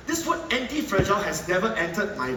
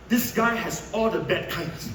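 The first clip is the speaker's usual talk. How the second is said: normal talking voice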